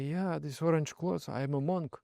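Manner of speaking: soft, hazy answer; dazed drunk reply. Retold or imitated. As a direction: answer from far away in bliss.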